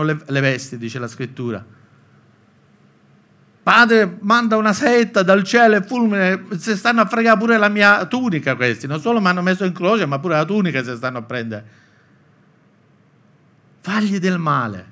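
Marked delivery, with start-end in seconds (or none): 3.6-14.9 higher and louder, an indignant plea for a lightning bolt; a sarcastic, exasperated demand for punishment; mock angry prayer. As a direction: ham up an outraged victim begging for revenge. Mock vengeful prayer, Roman-style slang.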